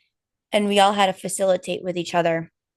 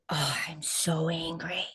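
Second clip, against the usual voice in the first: soft whispered admission, softer; whispered confession; 8 dB quieter, slower than usual. Retold or imitated. Whispering her own anger during the retreat exercise. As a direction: breathe it out as a secret. Inner voice during emotional release.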